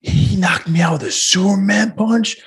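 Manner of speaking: slow, high, stunned question; dazed disbelief. Retold or imitated. Friend mimicking the fighter learning how he lost, slow high disbelief. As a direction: let the news sink in slowly, bewildered. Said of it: "And then you're like".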